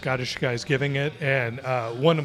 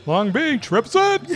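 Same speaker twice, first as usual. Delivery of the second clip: shout-out; 10 semitones higher, 6 dB louder, slower than usual. Pretend. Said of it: "shouting out hometown".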